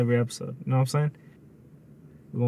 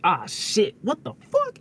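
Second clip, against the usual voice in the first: mock startled outburst; 5 semitones higher, slower than usual. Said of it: "instead of just being like".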